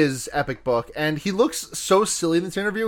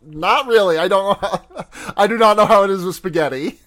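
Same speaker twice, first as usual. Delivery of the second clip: laughing through quote; 4 semitones higher, 6 dB louder than usual. Retold or imitated. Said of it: "And Ethan Hawke says".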